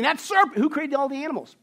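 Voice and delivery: whiny high blaming Adam; a mock-indignant Adam passing the blame. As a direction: point the finger, high and aggrieved. Pastor acts out Adam blaming God.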